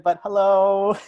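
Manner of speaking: high, loud drag-queen hello. Retold or imitated. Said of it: "I hope that's not copyrighted".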